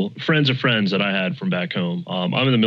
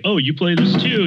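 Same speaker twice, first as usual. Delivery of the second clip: surprised; 9 semitones higher, 3 dB louder than usual. Retold or imitated. Surprised question to friend quoted.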